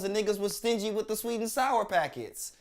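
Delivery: ordinary talk, nothing marked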